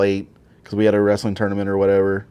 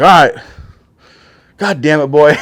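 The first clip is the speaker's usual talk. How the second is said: exasperated grandpa; 5 semitones higher, 9 dB louder than usual. Retold or imitated. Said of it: "He's like".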